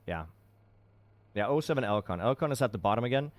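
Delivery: ordinary talk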